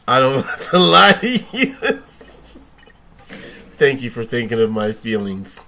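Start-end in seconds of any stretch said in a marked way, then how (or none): 0.1-2.0 laughing. Real, not acted. Laughing through a line.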